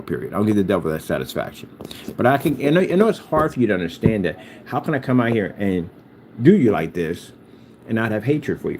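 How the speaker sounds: usual speaking voice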